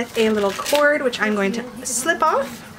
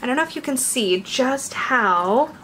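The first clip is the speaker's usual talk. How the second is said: ordinary delivery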